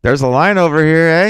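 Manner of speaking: put-on Canadian accent. Cashier telling the band about the line, loud mock Canadian accent. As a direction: play the polite Canadian clerk, "eh?" at the end. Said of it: "they're like".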